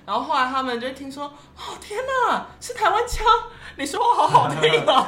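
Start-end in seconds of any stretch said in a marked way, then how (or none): 1.6-5.1 excited. Retold or imitated. Quoting a gushing Beijing colleague.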